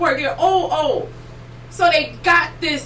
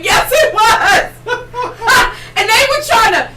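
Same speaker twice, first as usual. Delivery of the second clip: laughing; 9 semitones higher, 5 dB louder, slower than usual. Real, not acted. Cracking up while retelling.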